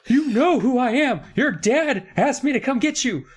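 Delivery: hammed-up annoyed kidnapper pleading with the kids; exasperated [name]. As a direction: whine it like a fed-up grown-up caught out.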